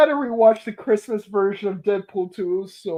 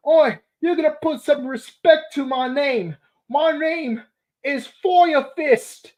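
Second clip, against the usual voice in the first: raised, slowed villain boast; Fire Fist impression; 6 semitones higher than usual. Fan quoting Fire Fist's threat, lightly voiced boast. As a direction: puff up like a fire-fisted bruiser. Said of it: "I know I don't actually sound like Fire Fist".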